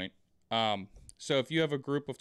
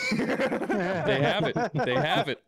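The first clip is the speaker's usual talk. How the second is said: laughing; 5 semitones higher, 5 dB louder, much slower than usual. Real, not acted. Excited about Double Dragon.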